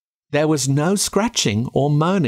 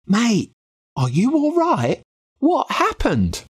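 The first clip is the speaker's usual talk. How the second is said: put-on worried boy; 9 semitones higher than usual. A narrator's reading. Boy rushes to his hurt friend.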